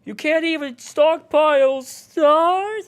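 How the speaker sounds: high, slow strained yell; attempted yell. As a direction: try to yell it out. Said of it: "the one where I tried to yell".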